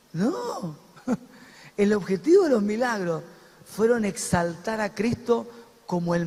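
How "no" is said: usual speaking voice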